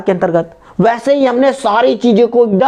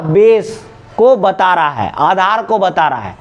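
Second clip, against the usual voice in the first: usual speaking voice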